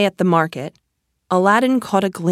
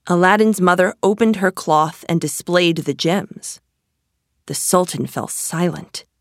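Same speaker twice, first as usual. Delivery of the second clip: ordinary delivery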